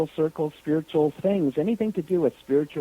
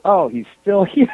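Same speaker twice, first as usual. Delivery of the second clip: higher, loud quote breaking into laughter; loud mock surprise dissolving into laughs; laughing retold exclamation; 4 semitones higher, 9 dB louder than usual. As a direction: imitate his remark and crack up. Retelling man's remark, breaks into laughter.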